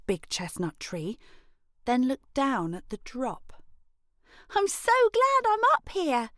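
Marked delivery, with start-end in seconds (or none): 4.5-6.3 much higher, bold and pleased; proud little girl. Acted. Brave girl boasts from the treetop, high and proud. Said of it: "the brave Elray said".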